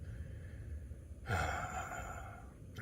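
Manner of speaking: long sigh of dread; heavy sigh. Real, not acted. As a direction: exhale long and wearily. Dreading snow and cold.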